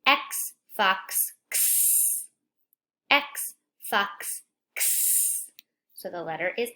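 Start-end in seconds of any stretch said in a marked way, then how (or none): 0.1-2.2 x as ks, fox ending on a hissed ks. Shown as a demonstration. Teacher drilling the card, hissing the x sound. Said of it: "now we see it again". 3.1-5.5 x as ks, fox ending on a hissed ks. Shown as a demonstration. Teacher drilling the card, hissing the x sound. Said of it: "now we see it again".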